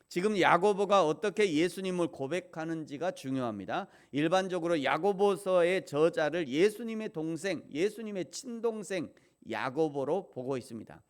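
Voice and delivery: emphatic, projected proclamation from the pulpit; forceful preaching. Real, not acted.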